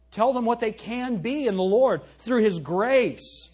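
slow, loud uplifting preaching; rising pulpit fervor. Acted. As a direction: lift them up in the Lord. Preaching climax.

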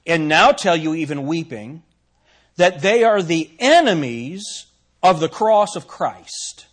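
slow, loud pulpit reading of scripture; solemn King James reading voice. Acted. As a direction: read the verse with weight and force. Reads scripture aloud.

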